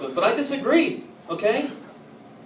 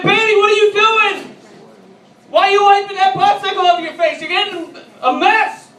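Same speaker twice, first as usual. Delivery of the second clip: loud, much higher panicked dad voice; alarmed dad; 9 dB louder than usual. Retold or imitated. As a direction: squawk at the daughter like a horrified father. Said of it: "I said".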